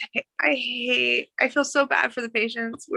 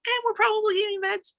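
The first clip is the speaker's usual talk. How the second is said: higher, gentle reassurance; a nurse comforting an embarrassed patient, gentle and higher; reassuring nurse; 9 semitones higher than usual. Retold or imitated. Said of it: "And it's like".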